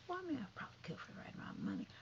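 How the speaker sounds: quick breathy whisper, half joking; whispered aside. Real, not acted. Gossip vlogger whispering a joke to viewers, quick and hushed. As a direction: slip in a fast whispered joke.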